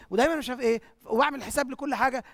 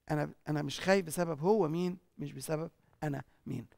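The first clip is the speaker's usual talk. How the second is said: soft repeat; 7 semitones lower, 6 dB quieter than usual. Real, not acted.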